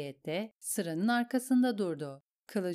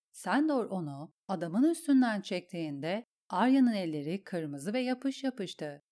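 usual speaking voice

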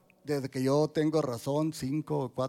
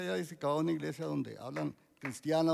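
ordinary delivery

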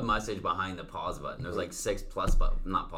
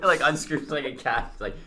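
ordinary talk, nothing marked

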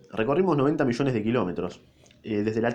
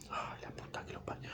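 whispered aside; 19 dB quieter than usual. Real, not acted. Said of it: "narrator's own aside".

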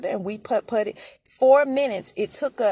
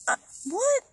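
high, incredulous what; retold startled objection; baffled; 13 semitones higher than usual. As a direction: pitch jumps up in disbelief. Balking at owing ten more.

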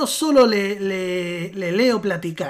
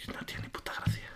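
whisper; 17 dB quieter, faster than usual. Real, not acted. Aside muttered under breath.

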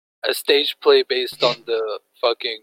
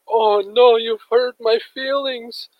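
put-on high slow whiny girl voice; mock the offended internet girl; 10 semitones higher, slower than usual. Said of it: "one of those girls on the internet".

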